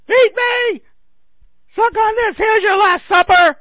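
full-force, very high screaming impression; Sam Kinison. Comic doing a late comedian's Jesus bit, screamed. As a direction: shriek it at the top of the voice. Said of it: "Sam Kinison, the, uh, late great comedian".

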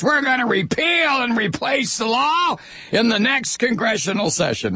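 high shouted politician parody; mocking politician voice. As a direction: ham up a blustering candidate. Mocking the candidates.

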